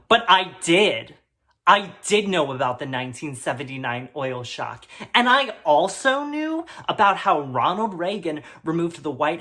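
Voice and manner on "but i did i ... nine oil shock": indignant insistence. Acted. Defiant retort in narration.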